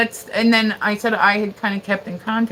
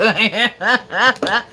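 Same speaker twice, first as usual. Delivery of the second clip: burst of real laughter; 2 semitones higher, 5 dB louder than usual. Real, not acted. Laughing at a viewer's long username. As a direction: let a big laugh out.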